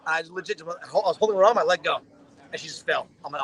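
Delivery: ordinary delivery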